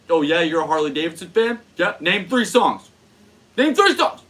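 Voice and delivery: high, loud, pushy mock shouting. Comic shouting a meme joke at a Harley fan, mock shouting. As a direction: bark the challenge like a smug know-it-all.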